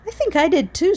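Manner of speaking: scared thief trying to sound brave; quivery voice. A narrator's reading. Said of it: "said Max in a quivery voice".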